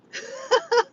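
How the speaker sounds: high, loud burst of laughter; amused laugh. Real, not acted. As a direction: burst out laughing at a chat message. Amused by the chat message.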